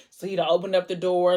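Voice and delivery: normal talking voice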